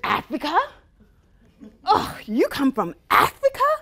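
loud, rising mock surprise with a touch of disdain; lightly voiced disdainful disbelief; condescending surprise. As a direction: imitate a friend sounding shocked and superior. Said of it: "the tone in which she said".

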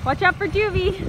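calling out. Real, not acted. Mom warning someone about Juvie over the mower, calling out. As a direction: call across the yard so they hear.

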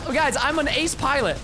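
normal talking voice

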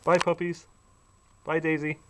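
talking to pets. Real, not acted. Saying goodbye to the dogs.

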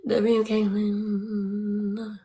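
long, lingering final name; drawn-out cheer. Real, not acted. Streamer repeating the cheer for Caitlin, drawn-out chant. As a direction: stretch the cheer right out, slowly.

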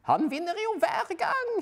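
high, loud character voice; bored know-it-all; detective dismissing the race to his wife; detective's voice. Acted. Detective unimpressed by ski race.

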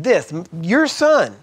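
"your son" drawn out slow and loud; stress on your son. Retold or imitated. Preacher quoting the elder son, slow and loud. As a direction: lean hard on "your son", slowly. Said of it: "He said".

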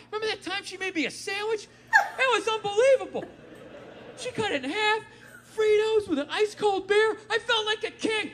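high, choking up with mock emotion; teary fond memory. Man alone in his car recalling the sandwich, mock teary. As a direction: reminisce as if about to cry. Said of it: "talking in the car".

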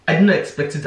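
usual speaking voice

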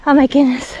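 usual speaking voice

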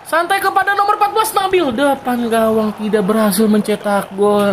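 high, excited rising call. Real, not acted. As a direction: build the excitement as the attack goes in. Attack near goal.

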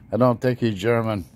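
put-on accented line broken up by laughter; laughing through it. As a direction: chuckle while asking, still in accent. Laughs at his own joke.

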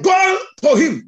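loud, high, drawn-out order; commanding quote. Retold or imitated. Preacher voicing an order from a Bible story, commanding. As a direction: call it out as a forceful command.

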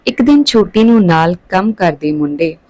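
ordinary delivery

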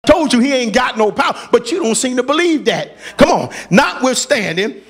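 ordinary delivery

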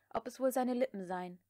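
usual speaking voice

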